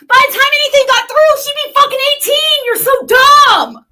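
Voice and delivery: high, loud yelling contempt; full-on angry shout; furious shout. Real, not acted. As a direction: yell it at her. Angry outburst at critic.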